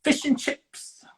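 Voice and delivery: high, slow put-on British accent. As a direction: drawl "Fish and chips" like a posh Brit. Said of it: "answered in a British accent".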